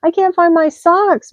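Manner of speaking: slow high whiny moan; mild moan about socks. A narrator's reading. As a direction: rabbit moaning, slowed. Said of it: "moaned Rabbit".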